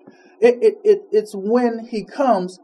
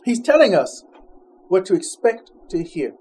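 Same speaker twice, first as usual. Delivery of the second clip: ordinary delivery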